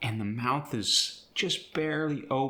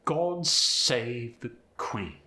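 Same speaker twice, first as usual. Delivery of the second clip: slow British lilt gliding through the phrase; drawn-out, poetic British stresses; British accent, stretched and gliding; British pitch glide; slower than usual. Shown as a demonstration. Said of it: "incorporate the British pitch glide".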